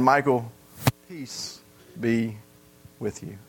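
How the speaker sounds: whisper-soft comfort to a scared man; tender Jesus voice. Acted. Imagined Jesus speaking to him.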